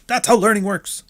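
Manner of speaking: high, emphatic mock retort; hammed-up exasperation, pitched well up. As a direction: hammer it home in a put-on high voice. Repeats the mocking retort.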